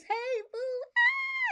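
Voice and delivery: very high squealing hello to a friend; excited greeting. Real, not acted. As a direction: squeal with joy as a friend arrives. Greeting a viewer who joined.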